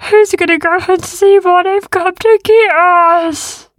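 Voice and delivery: slow, loud, high worried voice; nervous beast. A narrator's reading. The big beast frets to the others, slowly. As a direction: fret from behind the rock.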